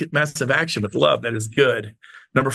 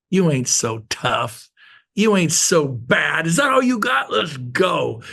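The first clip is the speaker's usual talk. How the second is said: defiant taunt; slower than usual. Pretend. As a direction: square up to your troubles, then yell the challenge. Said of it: "You know".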